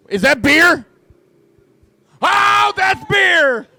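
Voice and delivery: high, loud delighted yell at the crowd; announcer thrilled by a beer in the stands; excited shout. Real, not acted. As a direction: shout it out like you just spotted free beer. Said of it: "Sorry, I got excited again".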